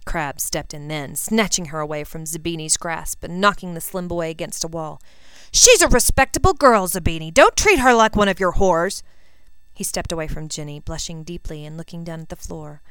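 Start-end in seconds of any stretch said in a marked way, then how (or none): 5.5-9.0 angry scolding. A narrator's reading. Crabbe shoves Zabini, scolds.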